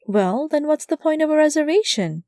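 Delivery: a touch of frustration, lifted high; a little frustrated. Acted. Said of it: "a little frustrated".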